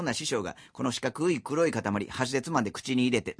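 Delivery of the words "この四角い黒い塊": ordinary talk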